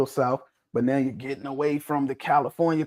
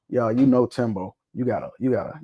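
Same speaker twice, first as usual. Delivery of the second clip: ordinary talk, nothing marked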